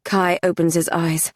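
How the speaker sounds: ordinary delivery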